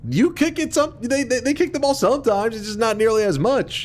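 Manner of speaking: excited ranting. Real, not acted. Heated argument with chat.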